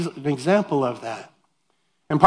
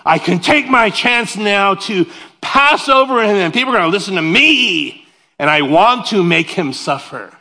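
mock scheming rival; 4 semitones higher, 5 dB louder than usual. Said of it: "they're like".

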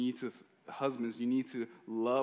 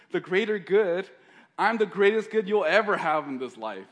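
higher, loud defiant comeback; indignant retort; 8 semitones higher, 12 dB louder than usual. Retold or imitated. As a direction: throw it back in his face. Wife retorts to husband.

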